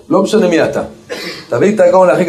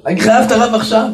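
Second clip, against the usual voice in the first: pitched-up, urgent demand for the rabbi; mock shout; 7 semitones higher than usual. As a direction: imitate him bursting in with a raised voice. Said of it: "בצעקות". (צעקה מחוקה בקול גבוה)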